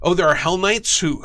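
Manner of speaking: normal talking voice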